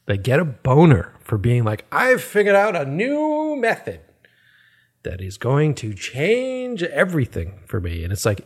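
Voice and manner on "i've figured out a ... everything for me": mocking quote. Mocking preachy vegan friend.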